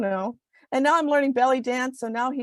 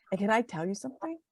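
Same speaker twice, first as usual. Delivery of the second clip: soft, lowered voice breaking into a laugh; confiding near-whisper; 8 dB quieter, faster than usual. Real, not acted. Guest confiding something funny on air, half-whispering. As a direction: drop your voice as if sharing a secret. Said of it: "I'm whispering".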